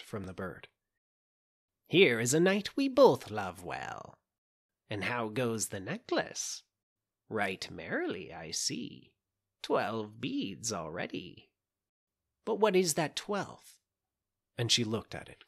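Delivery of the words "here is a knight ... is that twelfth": witch voice. A narrator's reading. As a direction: purr it like a scheming witch.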